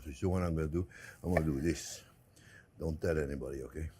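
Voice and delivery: sneaking a taste, telling viewers to keep quiet; conspiratorial hush. Real, not acted. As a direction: hush it like sharing a secret. Sneaking a bite after the video.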